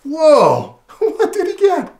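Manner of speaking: high, loud amazed exclamation; excited whoa. Acted. Reading to a child, loud amazed whoa. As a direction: burst out in amazement. Reacting to rabbit's elephant.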